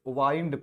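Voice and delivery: वाइंड with an eye vowel; वाइंड as in turning a key; long-i pronunciation. Shown as a demonstration. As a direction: say वाइंड to rhyme with kind. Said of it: "तब इसको हम क्या पढ़ते हैं?".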